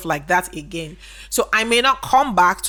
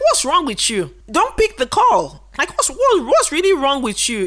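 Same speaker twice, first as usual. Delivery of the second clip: higher, incredulous retold rant ending in a laugh; mock fury at a shop that won't sell; exasperated outburst; 5 semitones higher than usual. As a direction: replay your disbelief at the useless sales rep. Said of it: "first of all, I'm pissed".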